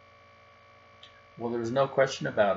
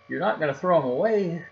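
higher, louder alarmed cub cry; 7 semitones higher, 5 dB louder than usual. Acted. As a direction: protest in alarm as a young cub. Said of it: "cried Brother".

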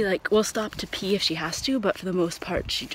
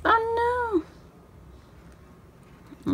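dismayed oh no; 5 dB quieter than usual. Real, not acted. Baking decoration goes wrong.

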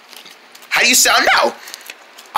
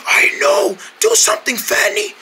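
hoarse, strained plea; high, airy rasp; lost voice; 12 semitones higher than usual. Acted. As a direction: urge with almost no voice. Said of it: "I'm afraid you lost your voice".

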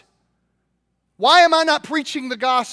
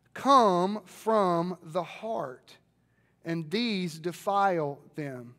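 slow reverent reading; 5 semitones lower, 7 dB quieter, much slower than usual. Real, not acted. Reading scripture softly, slowly.

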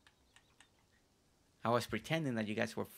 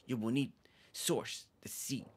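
low, hushed, brooding character voice; deep scary voice; 4 semitones lower than usual. Acted. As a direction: play a secretive dramatic villager. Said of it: "Always with that deep voice".

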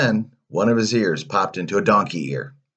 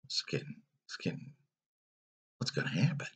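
hushed, breathy whisper; whispered aside to the listening child, full of suspense; 14 dB quieter, faster than usual. A narrator's reading.

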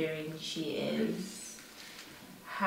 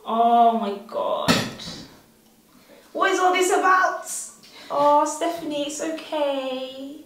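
much higher and louder, mock soothing; mock comforting; 10 semitones higher, 10 dB louder than usual. Teasing her teary sister with loud, high mock comfort. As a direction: overdo the fake sympathy for a laugh.

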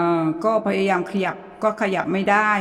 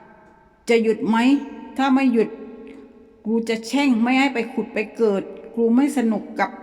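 furious cursing; 4 semitones higher than usual. Retold or imitated. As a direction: swear at it, fed up and scared. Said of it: "ด่าไปว่า".

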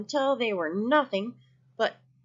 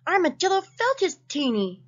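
usual speaking voice

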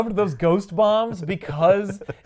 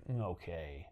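much lower, much softer, quick under-the-breath reassurance; mock muttered aside; 9 semitones lower, 18 dB quieter than usual. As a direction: mumble it fast to himself. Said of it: "And he's like".

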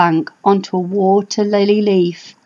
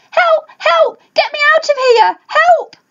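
high, urgent cries of Help!; yelling for help. Acted. Little dinosaur stuck in mud, yelling high for help. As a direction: call out stuck in the mud, pitched way up. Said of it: "having to yell".

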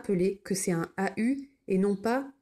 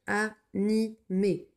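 Animé drawn out very slowly; slow dictation; much slower than usual. Shown as a demonstration. Teacher dictating Animé very slowly. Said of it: "dictation read slowly".

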